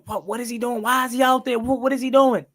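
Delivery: incredulous, rising-pitched protest; alarmed disbelief. Retold or imitated. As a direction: replay your alarm at a bad substitution. Retells alarm at substitution.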